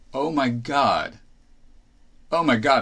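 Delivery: a startled oh my God as it sinks in; surprised realization. Real, not acted. Realizing he is thirty-three.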